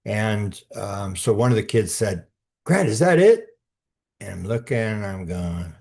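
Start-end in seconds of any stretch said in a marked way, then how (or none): 2.6-3.6 high, loud eager question; a kid blurting out the question; higher and louder kid's question; excited kid. Retold or imitated. Kid asks excitedly about flash.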